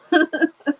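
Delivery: short bright laugh at the line; amused laugh. Real, not acted. Reader laughs at Anne's line.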